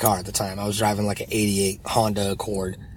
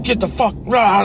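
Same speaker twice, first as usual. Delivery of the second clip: high mock yell; retold crazy yell; 9 semitones higher than usual. Yelling at the scared tourists, mock crazy yell. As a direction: ham up going all crazy. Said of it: "all crazy".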